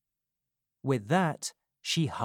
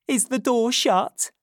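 anxious Nancy; 11 semitones higher, 7 dB louder than usual. A narrator's reading. Nancy anxious in secret meeting.

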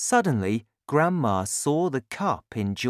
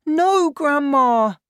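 put-on slow, high, loud boy's cry; drawn-out high shout; alarmed cry; 8 dB louder, slower than usual. A narrator's reading. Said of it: "George cried".

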